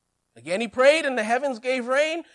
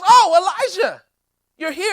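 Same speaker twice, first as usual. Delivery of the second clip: surprised; 7 semitones higher, 9 dB louder than usual. Acted. Obadiah startled to meet Elijah.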